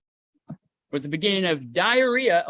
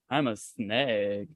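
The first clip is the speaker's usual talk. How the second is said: low, slow, drawn-out snake voice; 6 semitones lower, 6 dB quieter, much slower than usual. Pretend. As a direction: stretch it out as a camp snake.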